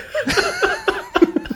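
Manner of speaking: high-pitched burst of laughter. Real, not acted. Co-host cracking up at the dubbing bit, laughing.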